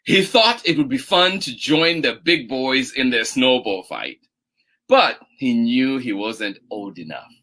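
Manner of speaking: slow, low storytelling voice; gentle bedtime-story pace; picture-book narration. A narrator's reading. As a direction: read slowly to little children, letting phrase ends sink. Reads picture book aloud.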